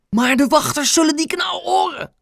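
very high, strained alarmed cry; stifled cry. A narrator's reading. Grandma fears guards will hear, crying out. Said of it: "riep oma zo zacht als ze kon".

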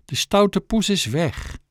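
calm the trembling dog gently; girl soothing a frightened dog. Acted. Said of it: "Rustig maar, zei ze".

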